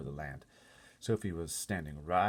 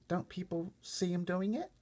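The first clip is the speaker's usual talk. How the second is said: put-on higher, small girl voice; little girl Sophie; 13 semitones higher, slower than usual. Sophie asking the giant about the other giants, small high girl voice. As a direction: speak up like a worried little girl.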